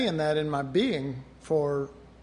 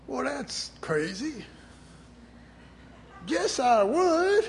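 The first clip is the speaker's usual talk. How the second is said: higher and slow, brash; young eager self; 8 semitones higher, slower than usual. Retold or imitated. Recalling his young brash reply to a warning. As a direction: play your cocky younger self. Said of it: "And I thought".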